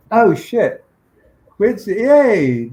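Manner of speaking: sudden high, loud yay for a friend; excited cheer. Real, not acted. Cheering Quincy in the chat, real excited shout. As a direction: burst out cheering at the screen. Chat member spots Bond poster.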